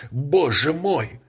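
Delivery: high, slow repeated cry. A narrator's reading. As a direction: say it again, still shaken. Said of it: "повторял он". (повторный взволнованный возглас)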